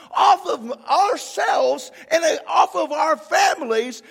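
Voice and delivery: loud, fervent preaching, near tears at the end; preaching at full force. Acted. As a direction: build the crowd up with conviction.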